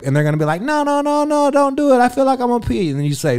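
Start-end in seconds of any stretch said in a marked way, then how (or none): 0.6-2.9 high falsetto mock protest; hammed-up falsetto begging; imitated pleading woman. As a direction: plead in a squeaky put-on woman's voice.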